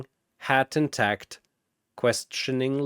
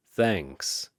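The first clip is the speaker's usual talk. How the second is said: lower, softer, deadpan thanks; flat; 5 semitones lower, 4 dB quieter than usual. Acted. Indy answering Shazam's compliment, flat and dry. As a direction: give nothing away. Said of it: "Indy says flatly".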